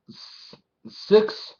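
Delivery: normal talking voice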